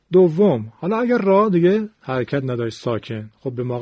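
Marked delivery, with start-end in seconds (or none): none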